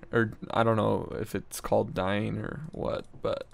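ordinary talk

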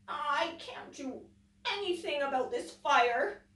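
a hopeless little croak; croaking frog. Acted. Frog gives up before the fire, croaking. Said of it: "croaked Frog".

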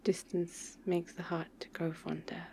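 slow, soft whispered saying; intimate whisper. Acted. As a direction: breathe it out slowly, close up. Soft intimate lover's talk.

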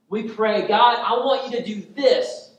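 high, loud, hammering sermon delivery; impassioned preaching. Real, not acted. Preacher exposing self-serving prayers to the congregation, impassioned. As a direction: press the point hard, high and loud.

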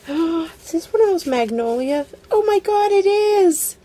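real gasping, high-pitched excitement; excited discovery. Real, not acted. Crafter recognising a magnolia die on her gift, excited discovery.

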